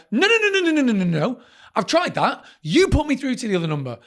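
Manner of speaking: rapid-fire high string of noes, then indignant protest; retold frustrated refusal. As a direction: act out cutting the operator off. Acting out irritated refusal on phone.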